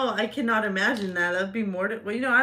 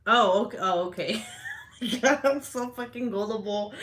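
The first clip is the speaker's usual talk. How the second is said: ordinary talk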